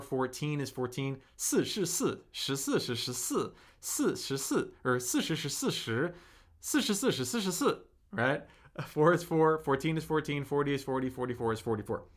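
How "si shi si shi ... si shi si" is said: careful Mandarin tones on "si shi si"; tongue twister. Shown as a demonstration. A Mandarin teacher demoing a tongue twister to a viewer, crisp tones. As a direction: spell out "shi si shi shi si" tone by tone for the chat. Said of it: "it's like four is four".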